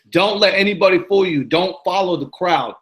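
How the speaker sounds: ordinary talk, nothing marked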